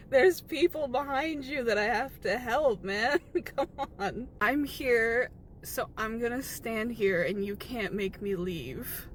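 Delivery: ordinary delivery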